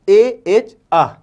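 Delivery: ए एच अह spelled slowly, letter by letter; slow spelled answer. Shown as a demonstration. Teacher answers her own question, spelling slowly. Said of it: "क्या लिखा है?".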